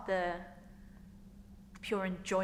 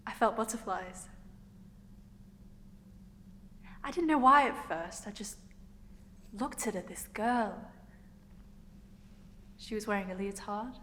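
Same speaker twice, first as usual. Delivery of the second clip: acted confession with long hesitant pauses; girl recalling first crush. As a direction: recall it in short, halting phrases. Performs monologue recalling first crush.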